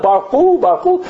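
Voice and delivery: high, rising mocking chant; kids laughingly mimicking. Kids all laughing at the accent, high mocking echo. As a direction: mimic the prayer call like teasing children. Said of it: "And the kids all laugh".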